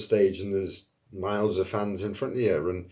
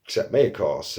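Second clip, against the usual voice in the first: ordinary delivery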